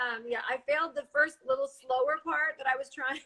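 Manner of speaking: usual speaking voice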